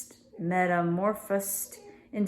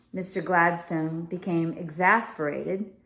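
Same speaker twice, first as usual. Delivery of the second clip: plain reading voice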